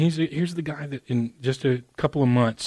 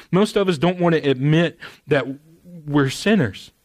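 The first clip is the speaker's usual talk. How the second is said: full-force preaching; impassioned preaching; 3 semitones higher, 4 dB louder than usual. Real, not acted. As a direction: preach it with rising fervour.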